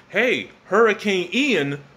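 high, slow mocking voice; mocking critic. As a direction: mimic the critics with scorn. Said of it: "You're saying".

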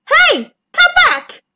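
high, loud call; calling after someone. Acted. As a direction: shout after fleeing prey. Shark calls after fleeing fish.

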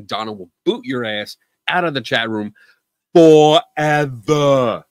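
pause, then a slow loud "forever"; dramatic pause. Shown as a demonstration. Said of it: "Dramatic pause".

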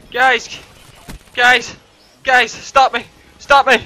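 high, punchy repeated cries; excited calling out. Real, not acted. Player begging guys to stop him, excited cries. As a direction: call out to the team in high bursts.

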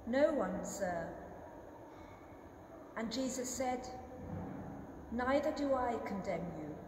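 usual speaking voice

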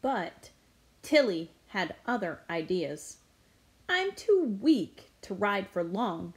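plain reading voice

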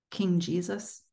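ordinary delivery

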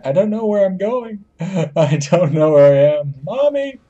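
high, hammed-up lost-child wail; mock panic. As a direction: cry out for mommy in playful panic. Lost in game, calls for mommy.

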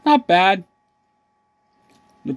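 speaking between bites; chewing while talking. Real, not acted. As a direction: mouth partly full of food. Seen 0: Bites stick at -5; lips pressed, jaw working until about 2.3s.

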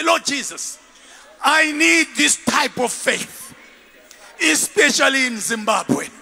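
loud, drawn-out plea to Jesus; emphatic prayer. Shown as a demonstration. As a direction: lead the crowd in fervent prayer. Models a prayer for congregation.

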